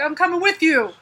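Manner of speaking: a hint of an eager owl; talking owl. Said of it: "and the owl's like".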